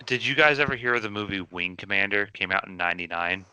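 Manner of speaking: ordinary talk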